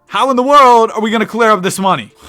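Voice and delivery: a real frustrated cry of how in the world; exasperated outburst. Real, not acted. Frustrated over salary cap.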